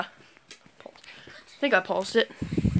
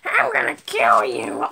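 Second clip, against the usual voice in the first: slow, very low distorted monster voice; Chucky; 12 semitones lower, slower than usual. Acted. Chucky introducing himself with a death threat, deep slow voice. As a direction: intone it slowly as a menacing doll. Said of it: "my name is Chucky".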